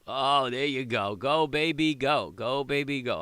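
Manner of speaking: loud, slow cheer urging the price on; root for the trade like a horse race; excited cheering. Real, not acted.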